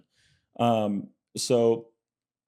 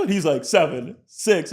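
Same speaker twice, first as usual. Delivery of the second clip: counting down, building louder; playful countdown; 8 semitones higher, 6 dB louder, slower than usual. Retold or imitated. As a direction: tease him with a rising countdown. Roger counts down in story.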